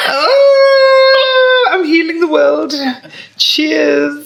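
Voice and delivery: hammed-up angelic healer; playing a saint for a coffee shop; mock Florence Nightingale. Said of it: "I'm Florence Nightingale".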